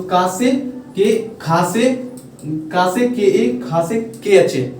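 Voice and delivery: slow, drawn-out spelling of का से के ए; letter by letter, slowly. Shown as a demonstration. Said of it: "spells out letters slowly".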